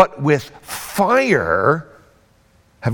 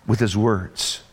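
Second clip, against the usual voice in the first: ordinary delivery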